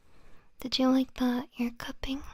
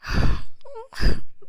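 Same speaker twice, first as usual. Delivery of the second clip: dreamy sigh; 12 dB louder than usual. Acted.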